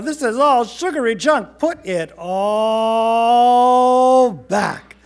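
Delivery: loud, high rant building to a long bellow; fed-up dad, stretching the final order out; exasperated scolding. A narrator's reading. Father scolds, orders treats put back.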